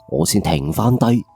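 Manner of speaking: usual speaking voice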